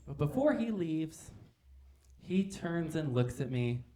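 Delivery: normal talking voice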